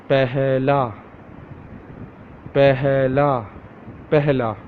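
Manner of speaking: Pehla drawn out as "peh-heh-la"; Urdu word. Shown as a demonstration. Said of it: "Repeat after me".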